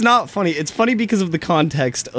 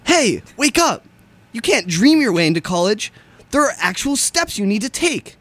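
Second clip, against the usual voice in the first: high, loud, hyped-up radio announcer; peppy high-pitched wake-up call to listeners; jumpy PSA; 7 semitones higher, 3 dB louder than usual. Acted. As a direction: punch it out like an over-excited college ad. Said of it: "little jumpy, like a".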